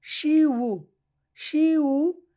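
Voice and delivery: শিউ drawn out loud and high, ending in a clear "u"; demonstrated pronunciation. Shown as a demonstration. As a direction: stretch শিউ into "shi-u". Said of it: "উ ব্যবহার করব".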